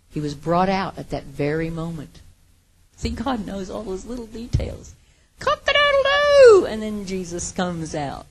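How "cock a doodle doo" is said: loud, high mock rooster crow. Preacher mimics the rooster crowing over Peter, loud and high.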